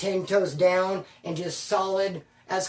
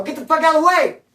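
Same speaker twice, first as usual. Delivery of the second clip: loud, high retold shout; forceful order; 8 semitones higher, 8 dB louder than usual. Telling a paisa to leave the work line, shouted order. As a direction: raise pitch and volume to order him off.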